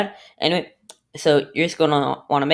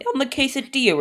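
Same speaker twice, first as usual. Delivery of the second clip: voice crack; 8 semitones higher than usual. Real, not acted. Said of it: "voice crack right there".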